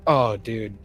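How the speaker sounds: normal talking voice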